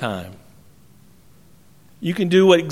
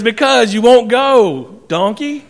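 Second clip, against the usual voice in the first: acted annoyance, higher, slow and emphatic; exasperated retort; 5 semitones higher, 4 dB louder than usual. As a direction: scold a stubborn donkey, drawn out. Balaam snaps back at donkey.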